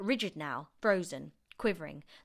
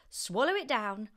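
raised, louder urging; cried out; 5 semitones higher, 6 dB louder than usual. A narrator's reading. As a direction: call out the order. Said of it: "George cried out".

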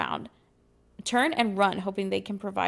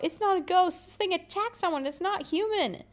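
shouting a warning; 10 semitones higher than usual. Acted. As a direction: yell at your friends to see the danger. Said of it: "point at the enraged creature and shout".

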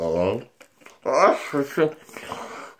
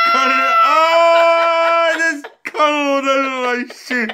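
laughing; 10 semitones higher, slower than usual. Real, not acted. Drool spilling on shoe.

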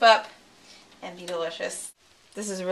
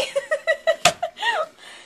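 high laugh. Real, not acted. Laughing at own struggle.

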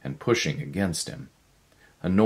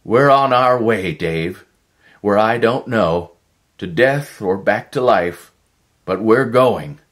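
higher and much louder; elated resolve; 6 semitones higher, 8 dB louder than usual. Acted. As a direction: proclaim it, bold and thrilled. Erickson elated before the leap.

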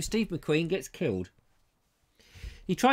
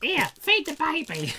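very high, drawn-out silly voice; put-on comic voice; 12 semitones higher than usual. Comic mimicking someone on the baby trip, high silly voice. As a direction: squeal it out slowly, high above your range.

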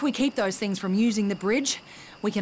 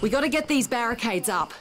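loud, high call to get the barricades up; rally everyone, loud and pressing; shouting orders to the others while under attack; urgent shout; 5 semitones higher, 6 dB louder than usual. Acted. Urgent call during the defense.

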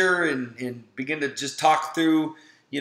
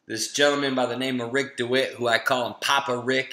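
usual speaking voice

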